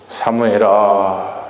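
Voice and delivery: very slow, drawn-out call of the name; God calling the boy Samuel slowly; slow divine call. Acted. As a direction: stretch the name out gently. God calls Samuel at night.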